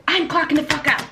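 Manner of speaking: retold defiance. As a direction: quit on the spot, fed up. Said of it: "I said".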